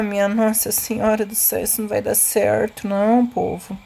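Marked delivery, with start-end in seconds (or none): none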